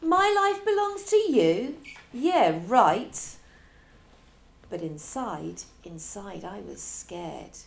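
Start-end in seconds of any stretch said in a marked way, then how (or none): 0.0-3.2 high, loud sarcastic echo; mock his words with scorn; loud sneering yeah right; scoffing mockery. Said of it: "I scoffed".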